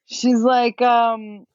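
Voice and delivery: normal talking voice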